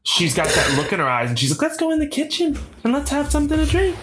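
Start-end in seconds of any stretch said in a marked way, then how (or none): 1.6-3.9 coy seductress.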